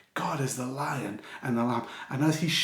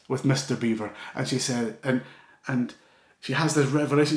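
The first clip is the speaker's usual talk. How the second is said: ordinary talk, nothing marked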